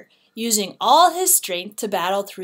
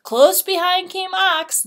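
usual speaking voice

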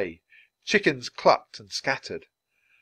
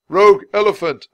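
very loud, high alarm call; shout; 9 semitones higher, 13 dB louder than usual. A narrator's reading. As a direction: raise the alarm across a market. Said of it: "a banana seller shouted".

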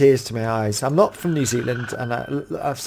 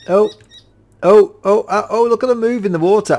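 high, loud, fast excitement; thrilled; 7 semitones higher, 6 dB louder than usual. Real, not acted. Player watching crabs swim, high thrilled rush. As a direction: rush out the delight.

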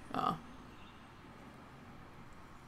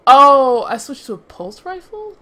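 surprised; 7 semitones higher, 12 dB louder than usual. Real, not acted. Realizes wrong weapon.

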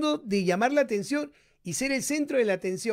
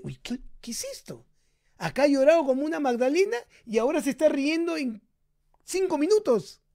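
mock baffled parent; 6 semitones higher than usual.

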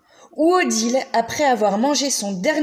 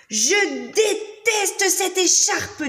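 slow, higher sulky whine; grumpy child; 9 semitones higher, much slower than usual. Acted. Odile refusing her scarf, slow sulky whine. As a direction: drag it out like a sulking little girl. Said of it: "dit Odile".

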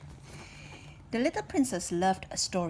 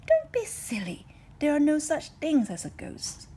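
higher, louder, firm dismissal; dad's voice; 8 semitones higher, 7 dB louder than usual. Acted. Dad tells the princess, high and firm. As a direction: brush off the silly fear brightly.

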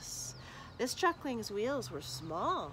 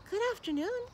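shy little truckling; 12 semitones higher, faster than usual. Acted. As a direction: greet the stranger hopefully, in a tiny high voice. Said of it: "said the ugly truckling".

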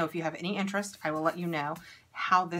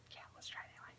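whispered aside; 20 dB quieter, much faster than usual. Real, not acted. Trying the lip plumper despite doubts.

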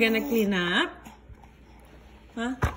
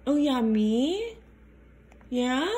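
ordinary talk, nothing marked

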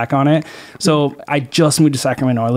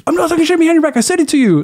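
much higher, panicky mock protest; defensive excuse; faster than usual. Replying to the angry coworker, mock defensive. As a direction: squirm out of it. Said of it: "And I'm like".

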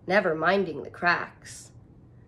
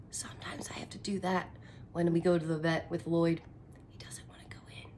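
whisper; 4 semitones lower, 8 dB quieter, faster than usual. Real, not acted. Teacher whispers aside to class.

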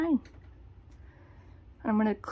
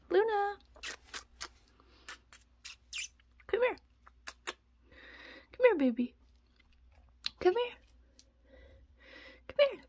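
very high coaxing call; calling the pet; 9 semitones higher than usual. Real, not acted. Owner calling her rat Luna over, high and coaxing. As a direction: coax the pet over, sing-song.

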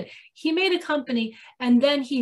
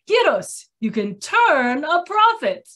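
louder, drawn-out imitation of a sales slogan; Greek salesman's pitch; 3 semitones higher, 5 dB louder, slower than usual. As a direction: deliver a hammed-up slogan, slowing at the end. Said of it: "not supposed to do a Greek accent".

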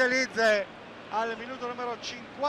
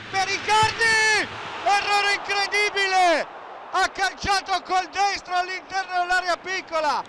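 higher, louder disbelieving shout; shouting commentary; 5 semitones higher, 5 dB louder than usual. Real, not acted. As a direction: yell at the miss. Missed chance shouted.

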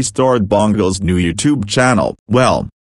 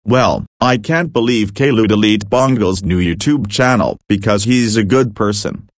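ordinary talk, nothing marked

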